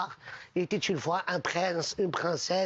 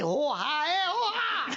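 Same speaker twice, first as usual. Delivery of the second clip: high strangled oh-ah cries; choked, higher cries of a man being squeezed; choking gasps; 7 semitones higher, slower than usual. Acted. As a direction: gasp and cry out as if being crushed. Replaying the dying man's last words.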